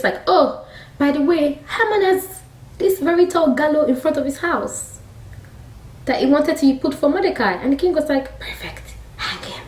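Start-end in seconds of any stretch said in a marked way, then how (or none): 0.3-7.5 high-pitched, casual-sounding tip-off; sly helpful suggestion. Retold or imitated. King's men mentioning Haman's gallows, mock casual. 8.4-9.6 near-whispered, much softer order; hushed verdict. Retold or imitated. King sentencing Haman to hang, hushed. King's curt sentence, retold.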